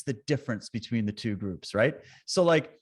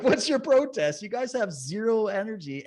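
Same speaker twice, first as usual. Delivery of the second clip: mock retort; 8 semitones higher than usual. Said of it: "I'm like".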